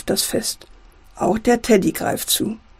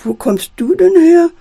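high, louder astonished question; 7 semitones higher, 5 dB louder than usual. A narrator's reading. Doll Gerda asks the unknown teddy, astonished. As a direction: ask it amazed at the stranger. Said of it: "fragt Puppe Gerda erstaunt".

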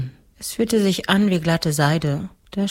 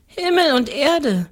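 put-on high, matter-of-fact child voice; little girl's answer; 11 semitones higher, 3 dB louder than usual. A narrator's reading. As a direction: answer simply as a little girl. Girl answers the curious ghost.

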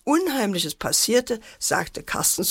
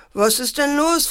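higher, mildly alarmed question; alarmed father; 7 semitones higher, 4 dB louder than usual. A narrator's reading. Father rushing in to crying children, mildly alarmed. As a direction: ask it urgently, pitched up.

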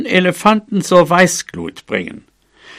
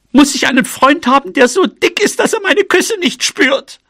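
very high, indignant mouse outburst; exasperated cry; 10 semitones higher than usual. Acted. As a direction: wail the complaint, climbing higher and higher. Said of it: "rief sie". (empört, mit immer höherer Stimme gerufen)

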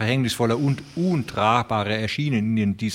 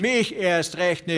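defiant vow; 7 semitones higher than usual. A narrator's reading. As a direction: spit out the refusal. Ranchers vowing defiance.

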